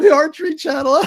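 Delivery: loud, high, laughing disbelief. Retold or imitated. Moviegoer laughing at a silly channel, loud incredulous laughter. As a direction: crow it through laughter. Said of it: "I remember laughing, going".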